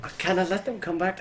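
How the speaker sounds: put-on low Irish accent; lightly voiced Irish brogue. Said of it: "do an Irish, low Irish".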